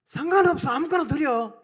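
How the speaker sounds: loud, brusque mock indifference; careless dismissal. Israelites offering forbidden things to God, in careless dismissal. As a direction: wave it off loudly. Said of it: "이렇게 말하고 드렸어요".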